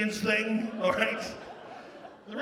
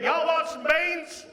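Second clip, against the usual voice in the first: high, slow Southern twang; Southern US accent; 9 semitones higher, 3 dB louder, slower than usual. Acted. Canadian mocking how Americans came to talk, Southern accent. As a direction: put on a hammed-up Southern accent. Mocking change from British speech.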